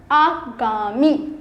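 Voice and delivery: आगामी drawn out very slowly, every syllable clear; slow model word. Shown as a demonstration. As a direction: stretch आगामी out slowly like a dictation. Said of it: "लेकिन आगामी नहीं होता". (आगामी बहुत धीरे, खींचकर)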